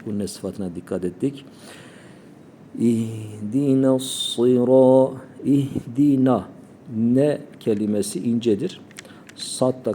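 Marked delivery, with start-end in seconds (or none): 2.8-6.4 drawn-out chanted İhdina; slow recitation. Shown as a demonstration. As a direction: recite slowly and melodically. Recitation demo.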